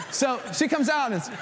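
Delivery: ordinary talk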